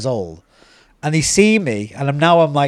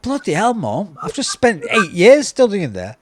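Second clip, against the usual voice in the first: high-pitched, incredulous complaint to mum; imitated exasperation, pitched well up; exasperated protest; 8 semitones higher than usual. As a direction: retell it as a wounded, disbelieving protest. Acted protest to unimpressed mum.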